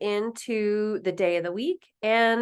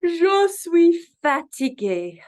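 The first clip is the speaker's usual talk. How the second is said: higher, louder, slow mock weary Je suis fatigué; acted tired; 7 semitones higher, 6 dB louder, much slower than usual. Shown as a demonstration. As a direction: groan out Je suis fatigué like you're exhausted. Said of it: "I am tired".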